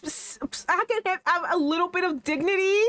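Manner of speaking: very high, pained near-crying wail; high broken whine, close to tears; distraught whine. Real, not acted. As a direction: plead for a scrap of dignity. Upset at story ending.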